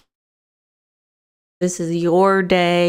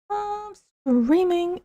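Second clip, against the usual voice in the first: sing-song gush; 11 semitones higher, 4 dB quieter, slower than usual. Real, not acted. Gushing about streaming.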